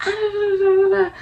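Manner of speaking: high squeaky babbling imitation of a sister; a silly high-pitched taunt; mock shrill babble. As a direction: squeal nonsense syllables to mock her. Mocking Amy's shrill comeback.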